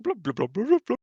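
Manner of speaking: bubbly blubs rising in pitch; underwater blub speak. Pretend. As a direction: gurgle nonsense as if submerged. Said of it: "it's just blub blub speak".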